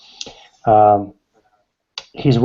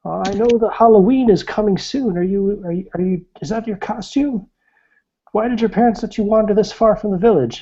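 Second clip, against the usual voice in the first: put-on much higher, cheery old hermit voice; old woodcutter voice; 11 semitones higher than usual. Game master voicing the old woodcutter greeting a stranger, high cheery old voice. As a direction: greet the stranger like a jolly old mountain man. Old man teasing about costume.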